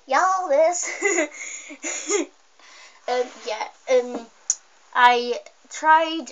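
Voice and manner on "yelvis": "Yelvis" drawn out, higher, with a Y at the front; Y sound before Elvis. Shown as a demonstration. Repeating the odd name for viewers, "Yelvis" with a leading Y. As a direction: stretch "Yelvis" from its opening Y. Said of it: "but it's like Yelvis".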